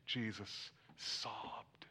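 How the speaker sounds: very slow whisper. Acted. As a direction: breathe it out, barely voiced. Reverent hush over verse.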